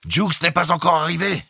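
high, loud questioning call; animated question. A narrator's reading. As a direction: call down urgently for news. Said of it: "s'anima pour demander". (question animée, à voix haute)

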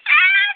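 high ticklish shriek; being tickled. Real, not acted. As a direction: shriek sharply, very high and loud.

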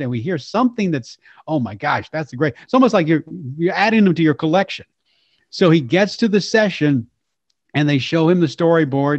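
usual speaking voice

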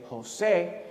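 "Jose" as ho-SAY, stress on the last syllable; accented final syllable. Shown as a demonstration. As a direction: land firmly on the end of "Jose". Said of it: "so that you say Jose".

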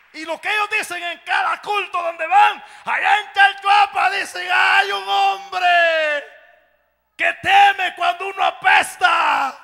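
high, loud preaching shout; full-force preaching. Acted. Preacher shouting the pastors' testimony to the church. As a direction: preach it at the top of the voice.

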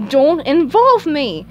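slow, loud, high order; Squidward barking. Acted. Cashier warning off his chattering co-worker, slow loud bark. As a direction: bark each word in annoyance. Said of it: "he barked".

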